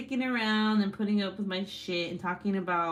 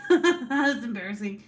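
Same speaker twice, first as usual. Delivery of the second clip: louder, words through laughter; embarrassed laughing; 7 semitones higher, 7 dB louder than usual. Real, not acted. Streamer caught by her husband, embarrassed laughing denial. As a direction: deny it while cracking up.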